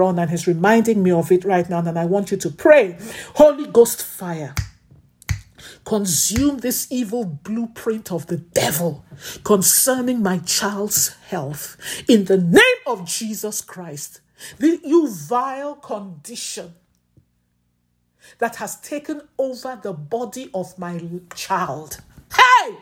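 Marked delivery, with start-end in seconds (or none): 3.4-22.0 commanding warfare prayer; fervent prayer. Acted. Commanding prayer over sick child. 22.3-22.7 sharp high cry out; sudden shout. Real, not acted. Sudden cry mid-prayer.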